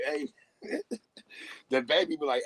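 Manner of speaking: normal talking voice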